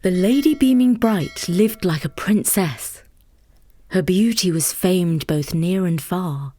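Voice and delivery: plain reading voice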